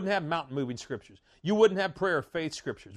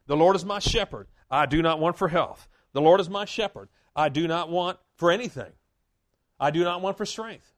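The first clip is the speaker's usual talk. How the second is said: bold declaration; 5 dB louder than usual. Shown as a demonstration. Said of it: "it's just good to say".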